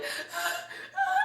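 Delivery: high, tearful laughing gasp; mock sobbing gasp; overwhelmed gasp. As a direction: gasp as if overcome on the phone. Acting overwhelmed on the call.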